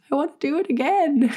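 eager and bright, almost bursting; excited. Retold or imitated.

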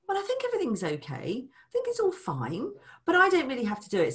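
a hint of an unbothered spender; carefree innocent partner. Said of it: "they're sort of going".

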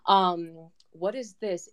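ordinary talk, nothing marked

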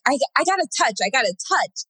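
high, urgent plea; urgently insisting. Retold or imitated.

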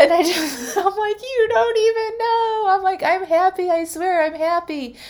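high, tearful laughing protest; laugh-crying. Retold or imitated. Telling the waiter she's happy, high and teary. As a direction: insist you're happy through tears.

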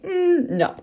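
high, knowing mock voice as the dog; the dog gently refusing his owner, in a high put-on voice; voicing the dog. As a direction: play the dog turning her down, amused. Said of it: "And Waylon was like".

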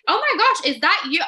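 high, loud, thrilled gasp of surprise; be thrilled he's calling to you; delighted surprise. Retold or imitated. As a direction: caught off guard by a nicker from the horse. Delighted to hear horse nicker.

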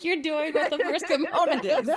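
high, laughing mock quote. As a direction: quote her through laughter. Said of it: "I was like".

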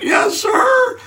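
very high, shouted squeak; terrified yes sir. Retold or imitated. Nervous student answering the doctor, squeaked high shout. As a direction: squeak it like a scared kid.

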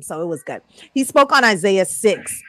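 ordinary talk

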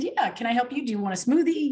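high customer-service voice; chirpy counter worker. Retold or imitated. Smoothie worker to stranger at counter, chirpy. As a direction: offer help brightly, pitched well up. Voicing herself at work counter.

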